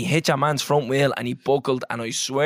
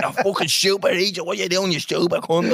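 higher-pitched mock outrage; retold angry rant, pitched up; mock abuse; 5 semitones higher than usual. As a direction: imitate someone hurling abuse at you. Retelling abuse he received.

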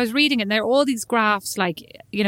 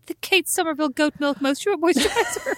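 high, laughing ad announcer read; advert voice; 7 semitones higher than usual. Retold or imitated. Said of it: "say the full name each time as advertising".

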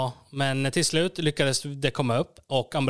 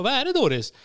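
alarmed question; 9 semitones higher than usual. Retold or imitated. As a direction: ask in sudden alarm. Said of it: "Jag bara". (högre, oroligt och högre ljudnivå)

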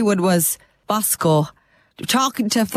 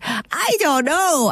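Bosco puppet voice; 7 semitones higher, much slower than usual. Retold or imitated. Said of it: "I was like".

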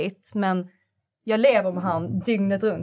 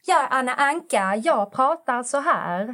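put-on higher, slowing, laid-on Skåne accent; Anna Anka; 5 semitones higher, slower than usual. Speaker exaggerates a celebrity's Skåne dialect, imitated Anna Anka. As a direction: lay the Skåne dialect on thick, pitched up. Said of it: "du kan ju säga Anna Anka".

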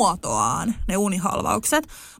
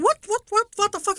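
high, bewildered mock yelp; startled disbelief; 12 semitones higher than usual. The boyfriend jolted awake, imitated in startled confusion. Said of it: "tää jätkä herää silleen, että".

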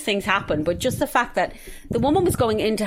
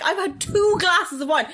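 indignant protest; 9 semitones higher, slower than usual. Retold or imitated. Retold protest to drinking mum.

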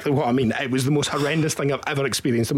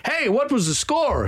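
Swedish guy; 11 semitones higher than usual. Retold or imitated. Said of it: "a Swedish guy, and he goes".

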